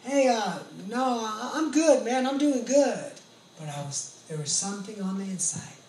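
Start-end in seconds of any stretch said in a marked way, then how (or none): 0.0-3.1 loud, high reassurance; brushing it off. Retold or imitated. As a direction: call out to shrug off their worry. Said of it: "I'm like".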